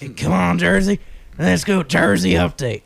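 slightly strained rocker rallying a crowd. Shown as a demonstration. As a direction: egg on Jersey like a rock frontman.

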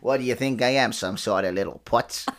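put-on mobster, slowing to a drop; Italian mobster accent. Improvising a mobster's retort, Italian mobster accent. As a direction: play an affronted movie mobster. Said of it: "like an Italian fucking mobster".

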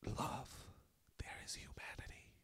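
whispered, hushed and reverent; dramatic whisper. Acted. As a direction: breathe it out like a sacred secret. Dramatic whisper ending the speech.

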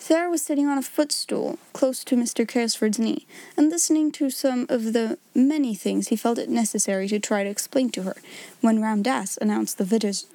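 ordinary delivery, nothing marked

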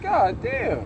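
high mock-shocked "God damn". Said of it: "I said".

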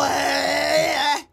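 loud, high, drawn-out mock British yelp; mock British accent. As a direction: stretch it into an over-the-top British cry. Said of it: "They go, "Quality".

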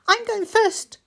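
put-on high, eager small boy; little boy voice. Mikey asking his sister, high and eager. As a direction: ask it wide-eyed like a small child.

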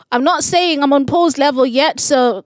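loud, high playful pleading; mock plea to God. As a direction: beg God, half joking, loudly.

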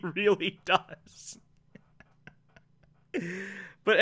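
a breathless whispered afterthought; whispered aside. Real, not acted.

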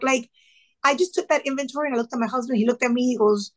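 usual speaking voice

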